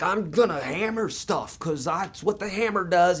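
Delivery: high, slow and loud mock-eager carpenter; gung-ho hammer wielder. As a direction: ham up a carpenter thrilled with his new hammer. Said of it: "you're like".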